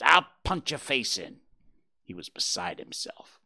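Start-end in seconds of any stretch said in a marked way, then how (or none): 0.0-1.4 loud angry threat. Acted. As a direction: threaten the rival bird. Jays squabbling.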